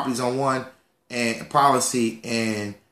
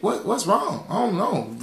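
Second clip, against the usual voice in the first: normal talking voice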